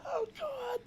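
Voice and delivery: big high-pitched helpless laugh; high laughter. Real, not acted. Laughing at the article.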